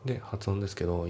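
ordinary talk, nothing marked

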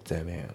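"ゼムイン" joined as "themin"; linked pronunciation. Shown as a demonstration. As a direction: carry the M into I in "ゼムイン". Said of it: "リンキングで".

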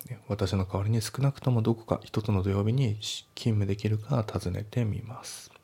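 ordinary talk

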